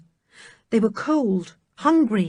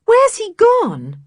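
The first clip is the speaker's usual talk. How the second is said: hurt; 13 semitones higher, 7 dB louder, slower than usual. Acted. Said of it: "quite hurt".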